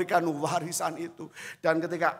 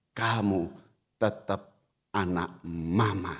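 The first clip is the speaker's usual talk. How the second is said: tender mother; 9 semitones lower than usual. Retold or imitated. Mother's loving words remembered.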